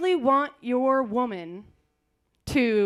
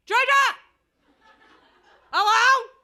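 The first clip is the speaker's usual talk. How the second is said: put-on much higher, loud old man calling; shouting deaf grandpa; 10 semitones higher, 6 dB louder, slower than usual. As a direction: yell like a half-deaf grandpa on the phone. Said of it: "this is my grandpa".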